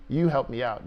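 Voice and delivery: usual speaking voice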